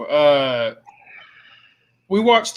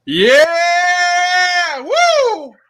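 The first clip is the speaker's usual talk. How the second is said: loud hype yell; excited shout; 14 semitones higher, 4 dB louder than usual. Real, not acted. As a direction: whoop like entering the ring.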